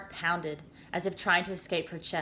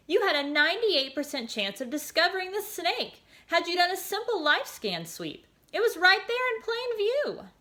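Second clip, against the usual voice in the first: much higher, patient reproach; disappointed voice; 12 semitones higher than usual. A narrator's reading. Voice lecturing the girl, higher patient tone. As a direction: explain it like a kind machine tutor. The voice explains failure.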